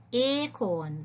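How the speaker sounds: acorn said slowly with a long ay; slow model word. Shown as a demonstration. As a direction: stretch out acorn for the class. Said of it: "listen to the sound".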